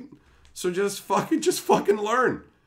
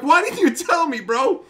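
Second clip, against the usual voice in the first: indignant; 8 semitones higher, 6 dB louder than usual. Retold or imitated. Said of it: "And I'm like".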